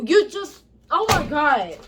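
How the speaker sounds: normal talking voice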